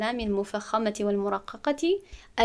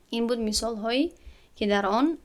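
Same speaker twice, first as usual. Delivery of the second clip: normal talking voice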